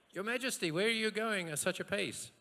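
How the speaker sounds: high, loud cry to a king; calling-out peasant. Acted. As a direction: shout it up as a hammed-up peasant. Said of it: "and cries out".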